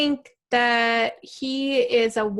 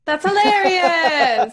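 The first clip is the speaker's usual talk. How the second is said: laughing; 7 semitones higher, 8 dB louder, slower than usual. Real, not acted. Laughing at Canada free speech.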